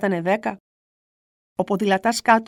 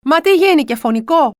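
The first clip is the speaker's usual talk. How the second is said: higher, louder alarmed question; neighbours shouting; 5 semitones higher, 6 dB louder than usual. Retold or imitated. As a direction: shout what happened.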